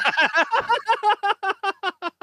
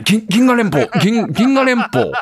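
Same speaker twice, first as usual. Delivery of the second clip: stunned stammer; 9 semitones higher than usual. Retold or imitated. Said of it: "銀河連邦に入ってるのか".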